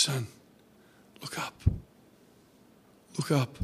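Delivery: "look up" dropped to a whisper, then softly repeated; hushed tender call. Retold or imitated. God speaking to his child in fear, hushed and tender. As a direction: lean in close and breathe "look up". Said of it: "he says".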